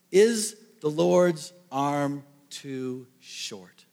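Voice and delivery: drawn out word by word; very slow. Shown as a demonstration. Lord answering Moses, drawn out slowly. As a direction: let each word hang, slowly.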